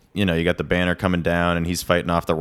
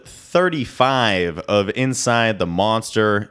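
host welcome; 4 semitones higher, slower than usual. Acted. Podcast host intro.

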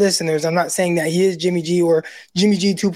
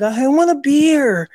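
drawn-out higher mock wife voice; slow, stretched request pitched way up; imitating wife; 8 semitones higher, 3 dB louder, slower than usual. Husband imitating his wife asking for a beer, mock whiny. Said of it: "my wife was like".

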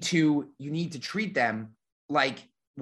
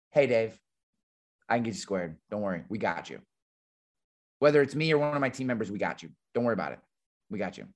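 calm reassurance; 4 semitones lower, faster than usual. Shown as a demonstration. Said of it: "I just say to 'em".

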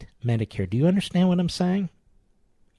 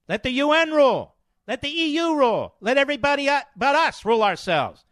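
sarcastic; 8 semitones higher than usual. Retold or imitated. Mocking open-border globalists.

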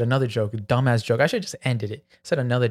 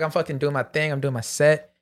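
ordinary talk, nothing marked

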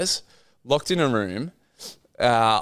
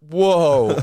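stunned whoa; 7 semitones higher, 5 dB louder than usual. Retold or imitated. Retelling reaction to big news.

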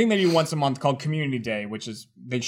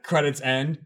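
normal talking voice

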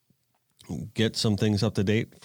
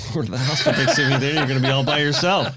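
laughing; 5 semitones higher, 6 dB louder, faster than usual. Real, not acted. Teasing invite to Thanksgiving dinner.

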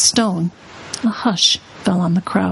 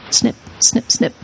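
snipping shears; 3 semitones higher than usual. A narrator's reading. Shears sound effect.

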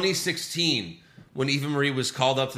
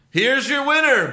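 high, loud announcer call; ring announcer; 11 semitones higher, 6 dB louder than usual. Retold or imitated. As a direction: announce it to the arena. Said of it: "Mike Rome says".

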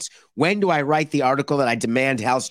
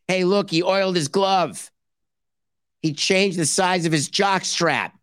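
sarcastic cheer; 5 semitones higher than usual. Pretend. Sarcastic cheer over rehab steps.

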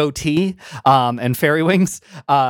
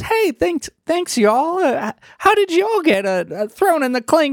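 pitched way up, wistful little fairy; high fairy voice; 12 semitones higher than usual. Acted. GM redoing the fairy's line in the right high voice. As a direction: redo it high and sighing.

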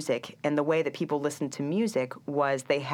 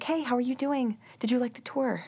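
much higher, sudden cheery switch; bright tour guide; 9 semitones higher than usual. Retold or imitated. Guide greeting tourists after tour, suddenly cheery and high. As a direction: flip to sweet hostess mode. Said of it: "she was like".

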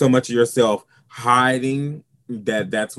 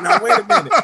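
a sudden, much higher, louder "wait a minute"; shocked double take; 6 dB louder, faster than usual. Retold or imitated. Arriving at theater school, caught off guard by the queens. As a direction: shoot the voice way up in mock alarm. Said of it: "I was like".

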